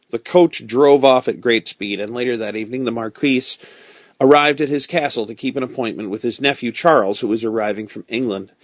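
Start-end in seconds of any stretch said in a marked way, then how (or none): none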